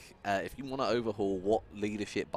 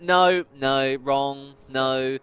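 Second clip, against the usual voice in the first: louder, imitating a dismissive quizmaster; mock quizmaster; 5 semitones higher, 13 dB louder, much slower than usual. Streamer retelling a quiz host shooting down his answer, loud mock rejection. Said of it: "And it was like".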